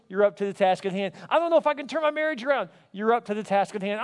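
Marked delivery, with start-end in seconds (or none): 1.3-2.7 high, hurried mock worry; worried objection. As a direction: fret quickly like a doubting spouse. Voices a doubter's worried objection.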